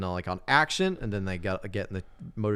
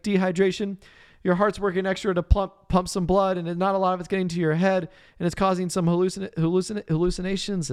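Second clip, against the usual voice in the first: high mock doctor voice; 10 semitones higher, 4 dB louder than usual. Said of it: "He's like".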